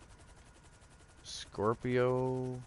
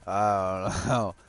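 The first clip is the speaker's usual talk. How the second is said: laughing; 4 dB louder, slower than usual. Real, not acted. Laughs mid-answer.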